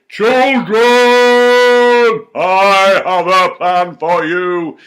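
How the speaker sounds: high, loud, slow godly voice. Pretend. Teacher imagining God speaking to the children, slow and grand. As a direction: call down from heaven, slow and grand. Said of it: "It'd be".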